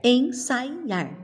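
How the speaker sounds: "Ensaiar" as en-SAI-ar; slow syllables, sai held together; joined diphthong. Shown as a demonstration. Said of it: "eu falo".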